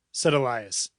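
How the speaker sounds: plain reading voice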